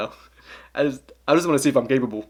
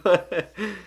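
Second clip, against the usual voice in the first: short high laugh; 7 semitones higher than usual. Real, not acted. Laughs at own French joke.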